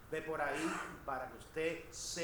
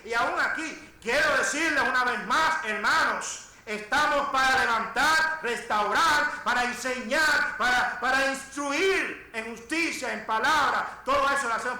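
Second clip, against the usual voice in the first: loud, emphatic pulpit delivery; full-force preaching; 7 semitones higher, 11 dB louder than usual. Acted. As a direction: hammer each phrase out to the congregation. (predicación a plena voz)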